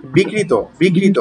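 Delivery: বিকৃত with an open first syllable; no doubled k in বিকৃত; correct pronunciation. Shown as a demonstration. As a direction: say বিকৃত as "bi-krito", one k. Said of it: "উচ্চারণ হবে".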